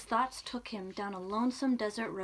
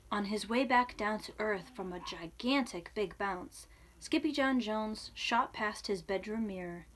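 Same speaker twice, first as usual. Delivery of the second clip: usual speaking voice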